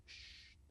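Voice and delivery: soft shh; shushing. Shown as a demonstration. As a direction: hush someone gently. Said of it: "You are hushing".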